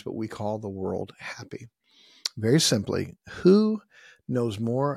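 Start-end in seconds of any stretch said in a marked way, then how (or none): 0.0-1.7 soft whispered confession to the flock; half-whispered, almost to himself; whispered aside. Real, not acted. Aside to the congregation.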